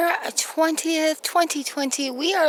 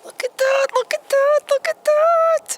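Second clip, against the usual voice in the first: ecstatic; 7 dB louder than usual. Real, not acted. To her viewers as the planets appear, ecstatic. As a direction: squeal with pure delight. Planets finally come into view.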